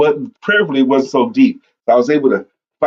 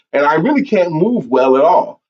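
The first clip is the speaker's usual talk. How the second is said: usual speaking voice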